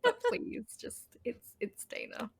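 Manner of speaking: soft whispered plea; pleading whisper. Retold or imitated. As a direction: whisper it, begging a little. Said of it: "I'm like".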